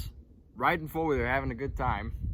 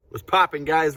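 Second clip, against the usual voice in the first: louder, higher hype hello; excited greeting; 4 semitones higher, 6 dB louder than usual. Real, not acted. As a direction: greet the viewers with energy. Vlog intro greeting.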